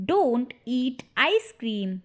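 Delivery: "Don't eat ice cream" slowly, word by word; slow repeat. Shown as a demonstration. Slower repeat for learners. ("Don't eat ice cream" धीरे, शब्द अलग-अलग)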